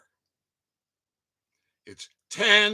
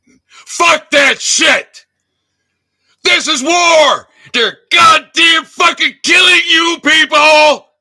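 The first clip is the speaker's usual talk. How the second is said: furious yelling, much louder and higher; ranting shout; 6 semitones higher, 10 dB louder, slower than usual. Real, not acted. Yelling at viewers, done being civilized: all-out furious shout. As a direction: drop the civility and roar it out. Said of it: "I did come here to rant".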